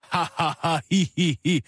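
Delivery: dry, unamused ha-ha and hee-hee; mocking fake laugh. Shown as a demonstration. Host showing how ridiculous laughter exercises are, mocking dry laugh. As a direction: recite the laugh syllables without any real laughter. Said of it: "det der, det er latterlighed".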